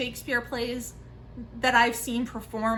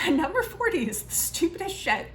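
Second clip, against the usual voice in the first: laughing; 7 semitones higher than usual. Real, not acted. Embarrassed about an unread classic.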